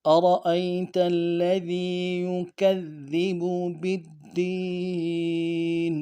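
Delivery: slow melodic tajweed chant; Quran recitation. Shown as a demonstration. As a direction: recite the verse with care.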